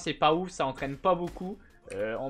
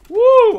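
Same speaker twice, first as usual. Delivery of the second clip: loud, high "wouh"; high whoop; 16 dB louder than usual. Real, not acted. Streamer reacting during aim practice, high whoop.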